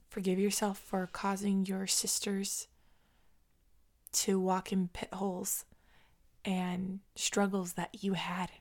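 hushed, soft, with long pauses; tender self-forgiveness. Real, not acted. Woman telling her eighteen-year-old self to forgive, tender and hushed. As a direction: speak gently, almost under the breath.

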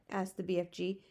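ordinary delivery

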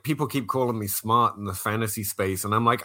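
normal talking voice

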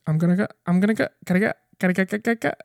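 rapid stuttered looping words; mock stroke gibberish; fake glitching stroke. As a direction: sputter like a broken record. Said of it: "A stroke".

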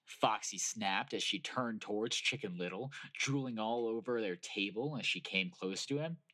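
ordinary delivery

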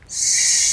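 long, loud hiss; snake hiss. Acted. A snake hissing in the dark jungle, loud.

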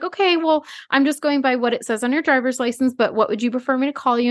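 high, gentle mock reassurance; overly sweet cop voice; mock soothing officer. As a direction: imitate the officer calming her. Mimicking polite officer.

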